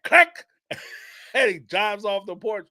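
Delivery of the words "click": imitated gun click.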